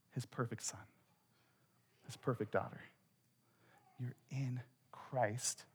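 very soft, reverent near-murmur; hushed awe after a big build; tender hush. Real, not acted. Preacher drops to tender hush.

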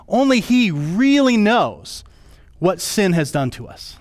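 ordinary delivery

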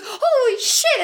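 high-pitched mock scream, half laughing; Luke's scream. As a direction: squeal it in imitation of a shocked man. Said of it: "Luke screamed".

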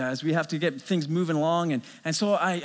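ordinary talk

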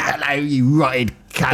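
Cockney insult. Acted. Continuing the Cockney bit.

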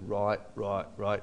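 a low, lightly Australian "right, right, right"; Australian catchphrase. Retold or imitated. Road manager's habitual phrase, lightly put-on Australian voice. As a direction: mimic his habit with a light Australian lilt. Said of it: "He always said".

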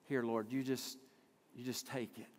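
soft, low, meek prayer; mild mock resignation; submissive. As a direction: hand it over meekly and quietly. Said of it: "submissive of".

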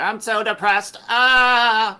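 loud, high hammed-up despair; mock wailing. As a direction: wail it out like a melodramatic witch. Mimics wailing witch in game.

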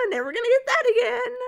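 high, loud mock crying; hammed-up despairing whine; mock wail. Child actor laments the take not taped, mock wailing.

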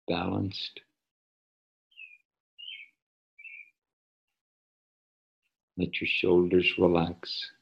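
soft, unhurried meditation voice; soft spa whisper. Acted. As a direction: ease them gently into stillness. Leads a guided meditation.

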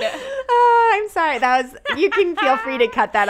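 talking loud and high through laughter; laughing apology. Real, not acted. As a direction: apologize while still cracking up. Still laughing after Uranus joke.

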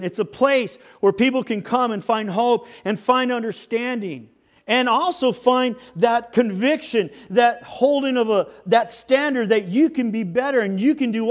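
high, loud, driving sermon; impassioned preaching. Acted. Preaches at full force.

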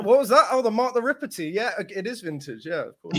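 high, bright, acting casually surprised; mock surprised reply. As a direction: play it like caught off guard. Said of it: "I was like".

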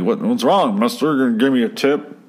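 a hint of an odd, eager delivery boy; lightly voiced Jimmy Stewart type. Odd paperboy asks the customer for a tip, lightly voiced.

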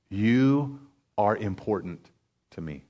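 hushed, slow and low, sinking away; God. Acted. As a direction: murmur it softly into the listener's ear. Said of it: "trying to whisper into David's ear".

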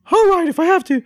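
very high, grudging cartoon falsetto; Nikki Moss. Cartoon correspondent told to stand by, grudging high falsetto. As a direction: give in with a squeaky mouse voice.

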